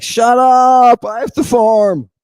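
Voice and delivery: hammed-up angry shout, high and loud; Cain. Cain snaps at his brother, mock-angry shout. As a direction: yell back like an irritated brother. Acting out Cain snapping back.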